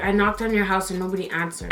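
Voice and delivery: low-pitched, subdued retold excuse; understated near-tears; about to cry. Repeating the lie to cousin, mildly about to cry. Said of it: "So I was about to cry".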